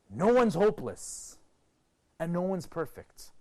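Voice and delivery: ordinary talk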